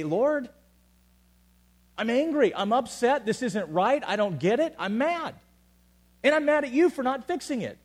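high, loud, raw complaint; angry cry to God. Retold or imitated. Preacher voicing David raging at God, loud and high. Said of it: "be like David and say".

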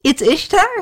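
high, amused disbelieving question; tipsy man checks the name a second time; giggly repeat question. Acted. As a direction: ask it again, giggling at the answer. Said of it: "he asked again".

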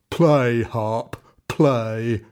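loud giant's shout. A narrator's reading. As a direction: bellow at the harp. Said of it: "shouted the giant".

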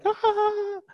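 sudden high squeal of panic; panicked yelp. Retold or imitated. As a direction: yelp like someone freaking out. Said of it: "you're tempted to just like".

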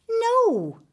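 high, loud flat no; stubborn refusal. Acted. Potter refusing the prime minister's gold, high and loud. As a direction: dig in your heels.

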